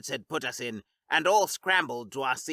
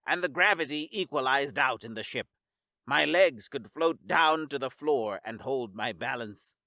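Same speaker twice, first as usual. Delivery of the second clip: plain reading voice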